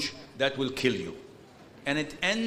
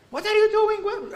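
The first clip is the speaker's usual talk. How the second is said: very high, loud exasperated yell; re-enacted shouting; 6 dB louder than usual. Counselor shouting at the people who come to him, exasperated. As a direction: shriek it in mock outrage. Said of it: "And I start shouting at them".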